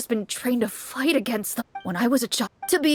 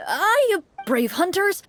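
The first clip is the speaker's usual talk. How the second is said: pleading townsman; 9 semitones higher, slower than usual. Acted.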